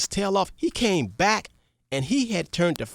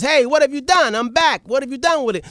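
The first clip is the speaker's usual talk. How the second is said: higher, louder commanding king; king calling out; 7 semitones higher, 6 dB louder than usual. Acted. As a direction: call out like a king back home. King questions returning servant.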